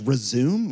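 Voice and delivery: "Resume" said as ri-ZOOM, like the verb; puzzled question, saying "Resume" as "rih-ZOOM"; misread resume. Retold or imitated. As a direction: read "Resume" without its final "ay". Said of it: "she was British, and she's like".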